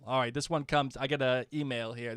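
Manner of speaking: ordinary talk, nothing marked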